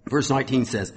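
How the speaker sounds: ordinary talk, nothing marked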